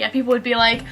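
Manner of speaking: ordinary talk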